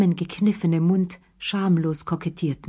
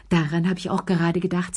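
ordinary delivery, nothing marked

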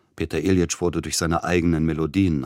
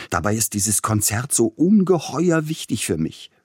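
higher, pleading lament, lightly voiced young man; 6 semitones higher, 4 dB louder than usual. A narrator's reading. Young pianist complaining to the composer, higher lament. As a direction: whine it out in a hint of despair. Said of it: "klagte er".

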